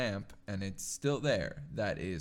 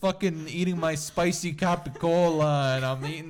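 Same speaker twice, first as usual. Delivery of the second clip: loud, higher hammed-up Italian accent; 6 semitones higher, 8 dB louder than usual. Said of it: "the voice I do".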